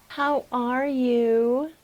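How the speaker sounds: very slow, stretched-out question; drawn-out greeting. Shown as a demonstration. Actor showing a third reading, very slow and drawn out. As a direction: draw out each word, rising at the end. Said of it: "three different interpretations".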